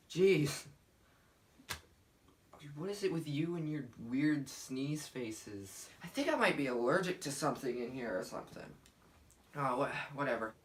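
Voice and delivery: usual speaking voice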